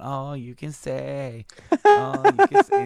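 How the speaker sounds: drawn-out singsong tease leaping high; mock prancing taunt; taunting sister. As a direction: imitate a little sister taunting, building to a high peak. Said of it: "she's, like, prancing around".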